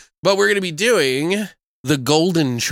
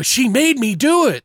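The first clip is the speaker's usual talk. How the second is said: high, loud, hammed-up protest; whiny pretend self-defence; mock pleading excuse; 10 semitones higher, 5 dB louder than usual. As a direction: play the husband wriggling out of blame. Acting a cheating husband's excuse.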